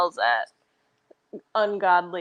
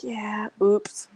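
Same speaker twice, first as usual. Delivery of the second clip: whisper. Retold or imitated. Sheepish whispered oops.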